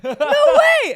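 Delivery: high, loud mock disbelief with a laugh; imitated freak-out. Retelling the streamer's shock at the raid, imitated freak-out. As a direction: shout it like a stunned fangirl, half laughing. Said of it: "And she started freaking out".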